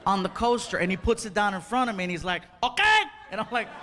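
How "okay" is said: high, loud imitated waiter okay; pushy waiter. Waiter sets coffee down, high insistent imitation.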